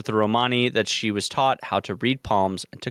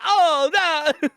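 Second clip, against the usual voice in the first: much higher, louder hammed-up cry; mock cartoon wail; 4 dB louder than usual. Retelling a cartoon skit, much higher hammed-up wail.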